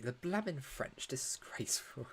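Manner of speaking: a playful scandalised hush; mock disgusted mutter. Joking jab at the French again.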